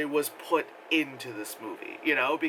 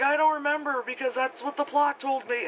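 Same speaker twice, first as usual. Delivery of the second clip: mock kid; 9 semitones higher than usual. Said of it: "the kid is like".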